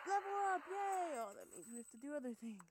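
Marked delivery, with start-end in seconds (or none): 0.1-1.2 high, loud celebratory shout; excited cheer. Real, not acted. Gamer levelling up in the game, cheering. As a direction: whoop it out like a small victory.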